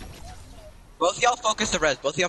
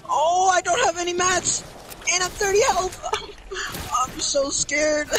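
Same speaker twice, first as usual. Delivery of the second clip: high, slow whiny mock fear; mock scared; 12 semitones higher, slower than usual. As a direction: whimper like a scared kid. Low health in storm.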